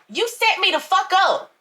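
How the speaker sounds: high, outraged, accusing; furious accusation. Acted. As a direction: throw the betrayal in his face. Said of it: "I screamed".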